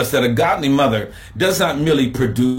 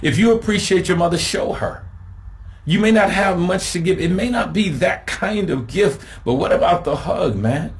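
higher, urgent exhortation with fast build; fervent preaching; 6 semitones higher than usual. Acted. Pastor urging listeners to show and honor mothers, fervent. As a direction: push the congregation to act, pitched up.

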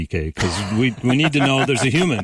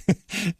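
high laugh; 11 semitones higher than usual. Real, not acted. Laughing at the Haaland story.

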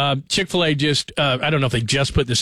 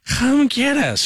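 much higher, slow, loose mock drunk call; half-hearted drunk impression calling for a ride; Harry Carey; 8 semitones higher, much slower than usual. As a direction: ham up a tipsy late-night call for a ride. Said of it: "I'm not Harry Carey".

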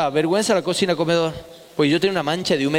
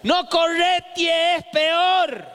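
very high, drawn-out crone call; old neighborhood women; 10 semitones higher, much slower than usual. Retold or imitated. Old women sweeping warn a boy chased by dogs, high drawn-out cry. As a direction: call out slowly like old women warning a child. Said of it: "las viejas uñudas esas que estaban barriendo".

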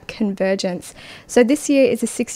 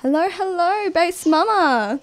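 calling out; 7 semitones higher than usual. Real, not acted. Host greets caller on air.

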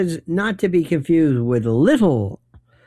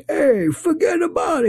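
high-pitched mock New York wiseguy; hammed-up New York mobster accent, pitched way up; affected New York caricature. Host mocking would-be New York mobsters, caricatured accent. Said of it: "who take on these almost like caricatures".

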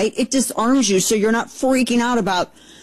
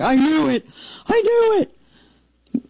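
rising to a very high, stretched-out "I knew it"; thrilled; 8 semitones higher, much slower than usual. Retold or imitated. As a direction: squeal with vindicated delight, slowing at the end. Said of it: "and I was like".

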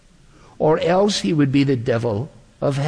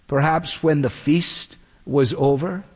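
usual speaking voice

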